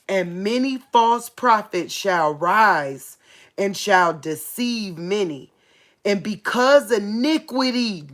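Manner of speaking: slow, emphatic preacher's reading; deliberate, weighty Bible delivery; forceful scripture reading. Real, not acted. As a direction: read the prophecy out firmly, stretching the key words. Reads scripture aloud to listeners.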